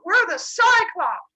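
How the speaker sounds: high, loud, drawn-out creature shout; Cyclops chorus. Retold or imitated. Creatures in the pit introducing themselves to Zeus, high loud voice. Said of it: "he has these creatures go".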